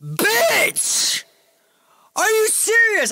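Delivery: very high, loud mock-outraged cry; outraged shriek. Man just told what he drank, outraged shriek. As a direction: shriek it in hammed-up disgust.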